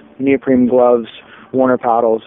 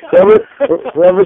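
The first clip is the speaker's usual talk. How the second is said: loud outburst; 12 semitones higher, 3 dB louder than usual. Real, not acted. Sudden outburst about the boat thief.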